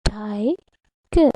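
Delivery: TIGER split into two beats, ti - ger; syllable by syllable. Shown as a demonstration. Teacher counting chin touches on the palm, syllable by syllable. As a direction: pronounce TIGER with a gap after "ti". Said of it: "How many times it touches?".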